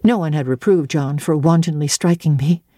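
ordinary delivery, nothing marked

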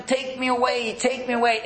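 high, ecstatic cry to God. Retold or imitated. Rebbe alone in his room crying out to God in rapture. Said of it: "He screams to Hashem".

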